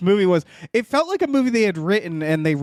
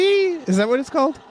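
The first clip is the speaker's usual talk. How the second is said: ordinary talk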